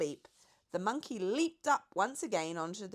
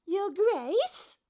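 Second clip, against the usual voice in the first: much higher, light giraffe voice; 13 semitones higher, 7 dB louder than usual. A narrator's reading. The giraffe reminds the Duke of his invitation in a high voice. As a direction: play the lofty giraffe.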